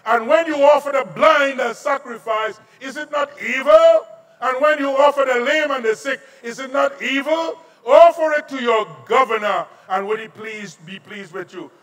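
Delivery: loud, high preaching of scripture; forceful preaching. Acted. Pastor preaching scripture to the congregation, loud and forceful. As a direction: thunder the verses at the congregation.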